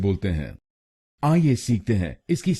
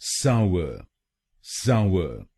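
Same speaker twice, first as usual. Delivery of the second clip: Sawar with the weight on साव; stress first syllable; 2 semitones lower, much slower than usual. Shown as a demonstration. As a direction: lean on साव, light final er. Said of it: "पहले शब्दांश पर ... जोर डालना है".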